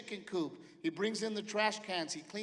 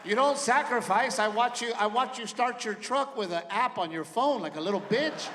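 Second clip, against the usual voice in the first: mocking son rant; 5 semitones higher, 8 dB louder than usual. Son mocking his dad, loud and scornful.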